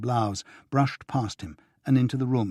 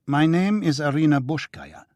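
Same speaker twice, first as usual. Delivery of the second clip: patient; 5 semitones higher, 4 dB louder than usual. A narrator's reading. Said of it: "she said patiently".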